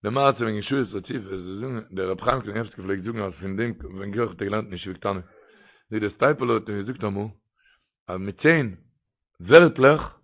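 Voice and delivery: usual speaking voice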